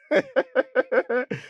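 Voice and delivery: high peal of scornful laughter; mocking laughter. Son mocks the name's meaning, high laughter. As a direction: laugh it off as ridiculous.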